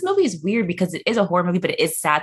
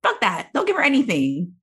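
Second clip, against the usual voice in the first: high-pitched worked-up rant; heated, high, laughing protest; very passionate; 11 semitones higher than usual. Acted. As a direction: get carried away in mock outrage. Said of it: "This got very passionate".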